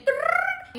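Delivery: high cartoon "Brrr" for fleeing legs; running sound effect. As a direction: make the Scooby-Doo running noise. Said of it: "I saw her little legs say".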